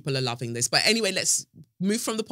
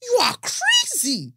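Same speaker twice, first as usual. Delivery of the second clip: much higher, loud, outraged mum; mum on the phone; 3 dB louder than usual. Retold or imitated. Mum phoning the airline demands her daughter's refund, shrieking. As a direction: scold the agent like an angry mother. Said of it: "get my mum to call".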